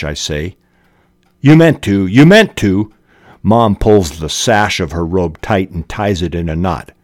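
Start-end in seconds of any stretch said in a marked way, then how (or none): 1.4-2.9 mild accusing mother. Acted. Mother mocks daughter's excuse.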